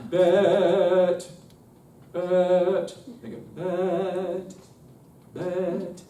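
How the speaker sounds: "bet" with the T placed high and forward. Shown as a demonstration. Choir director demos a high placed T, forward. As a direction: snap the T off up front. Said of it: "put it up high".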